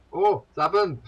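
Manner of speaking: loud, high mock-German exclamation; hammed-up German accent; bad German accent. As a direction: cry out as an alarmed German, badly faked. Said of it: "That's a bad German accent".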